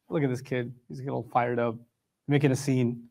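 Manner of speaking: low, quiet, dismissive aside. Acted. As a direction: shrug off the worked-up boy. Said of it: "not gonna take him too seriously".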